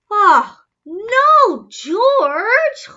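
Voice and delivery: loud high exclamation; dismayed cry. A narrator's reading. Mother dismayed.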